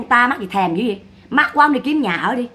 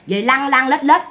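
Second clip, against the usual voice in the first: usual speaking voice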